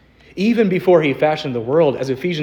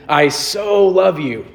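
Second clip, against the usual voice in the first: "I so love you" gushed, higher and slower; gushing mock quote; 4 semitones higher, slower than usual. Pastor imitating casual gushing love, mock sappy. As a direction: gush it like a sappy confession. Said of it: "as in".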